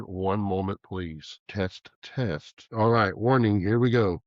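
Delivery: usual speaking voice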